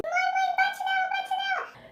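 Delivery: put-on high, pleading little-girl voice; scared child's plea. Little girl in her mother's arms begging her to hit the dog, high frightened plea. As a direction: beg mum like a frightened small child. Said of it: "falei assim".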